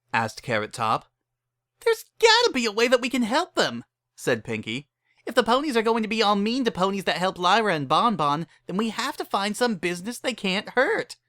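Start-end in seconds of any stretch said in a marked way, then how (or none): 1.8-11.2 much higher, bubbly pony voice; eager Pinkie pitching in; Pinkie Pie voice. Acted. Pinkie urges friends to help, bubbly.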